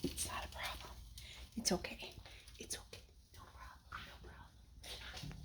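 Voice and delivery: soft whisper to calm herself down; hushed, breathy "it's okay, no problem"; whispered self-reassurance. Real, not acted. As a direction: murmur it under your breath after spilling. Spill sizzling, soothing herself.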